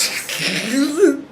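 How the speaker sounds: high, bursting laugh; high cackle. Real, not acted. Cracks up retelling the story.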